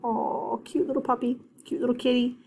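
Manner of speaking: mock cooing voice. Shown as a demonstration. Teacher shows kids the aw sound, cooing over a puppy. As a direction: coo like you just saw a puppy. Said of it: "they're gonna say".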